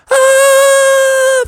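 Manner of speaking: long high mock scream; falling scream. Froggy released over the pond, high loud falling scream. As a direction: let out a loud squeal as you drop.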